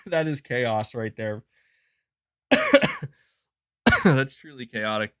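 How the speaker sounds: talking through a cough; croaky between coughs. Real, not acted. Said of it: "Sorry I'm coughing so much".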